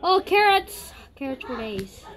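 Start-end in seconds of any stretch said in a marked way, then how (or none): 0.0-0.9 high, loud delighted exclamation; excited. Real, not acted. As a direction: burst out with glee at a find. Delighted at finding carrots.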